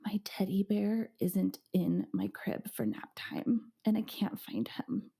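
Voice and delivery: put-on small whispered child's voice; toddler whisper. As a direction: whisper it like a little girl. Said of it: "she was just like".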